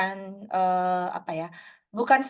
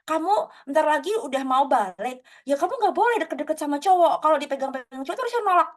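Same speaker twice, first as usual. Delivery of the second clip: higher-pitched imitation of a preachy scolding; mock lecturing; 6 semitones higher than usual. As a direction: lightly imitate someone lecturing a teenager. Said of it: "diceramahin kayak".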